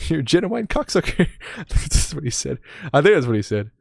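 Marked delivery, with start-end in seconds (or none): none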